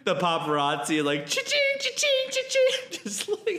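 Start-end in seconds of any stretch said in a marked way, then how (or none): 1.0-3.0 high squeaky cha-ching imitation; cha-ching sound effect. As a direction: mimic a cash register sound.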